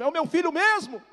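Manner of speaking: very high, loud incredulous wail; imitated tearful cry. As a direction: cry out the question at full pitch. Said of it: "Ela em prantos começou a falar".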